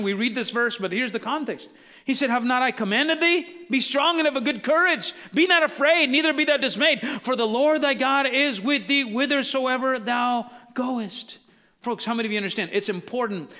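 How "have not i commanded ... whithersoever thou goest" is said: high, forceful preaching, slowing at the end; rousing scripture proclamation. A narrator's reading. Preacher reading the Lord's charge to Joshua, rousing proclamation. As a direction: proclaim the command with raised pitch and force.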